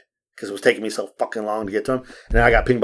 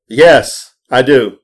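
emphatic, louder reply; retold firm answer; 7 dB louder, much slower than usual. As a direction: shut the question down firmly. Firm answer to whether he has wife.

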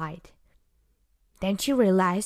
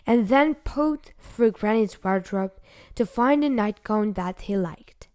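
ordinary delivery, nothing marked

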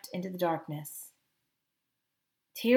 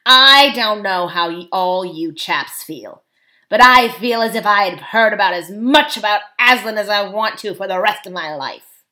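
loud, scornful dwarf leader; blunt and fed up, at full voice; dwarf who has heard quite enough; 11 dB louder than usual. A narrator's reading.

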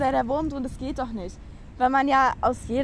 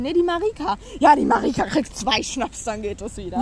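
brighter, louder quoted teacher; quoting a teacher; 4 semitones higher, 7 dB louder than usual. Retold or imitated. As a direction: chime in as the teacher.

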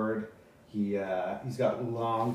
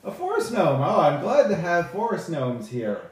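higher, projected delight at a newcomer; stagey high elf, pitched up and loud; high elf speaker; 4 semitones higher, 4 dB louder than usual. Acted. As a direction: greet a rare guest from the stage.

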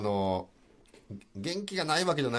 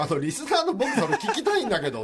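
higher-pitched mock protest with amusement; frustrated listener; 9 semitones higher than usual. Listener asking hosts to let him hear it, mock frustration. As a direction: complain playfully as the left-out listener. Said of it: "すごいモヤモヤしながら聞いてましたね".

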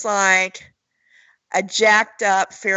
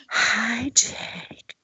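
breathy whispered come-on; sexy voice; 3 semitones higher, 4 dB quieter than usual. Pretend. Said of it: "Why don't you do your sexy voice".

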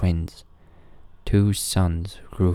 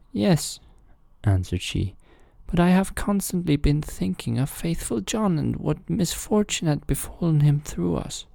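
queen's voice, pitched high; 11 semitones higher than usual. A narrator's reading. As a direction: confess troubled thoughts. Queen replies.

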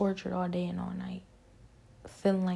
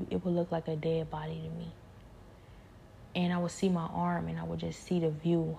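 ordinary talk

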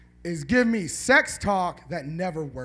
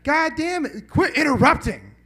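higher, louder mock-annoyed scold; 7 semitones higher, 6 dB louder than usual. Real, not acted. Comic scolding a heckling audience member, loud annoyed rebuke. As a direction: snap at the heckler to stop interrupting.

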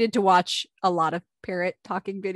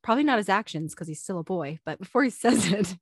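ordinary talk, nothing marked

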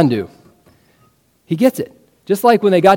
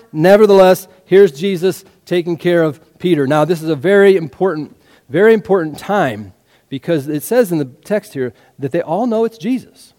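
ordinary talk, nothing marked

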